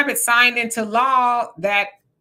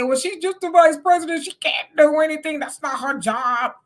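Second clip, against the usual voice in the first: high, mocking quote of her defenders; sarcastic mimic of the spokespeople; mock surrogate voice; 5 semitones higher than usual. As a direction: parody the excuse in a higher voice.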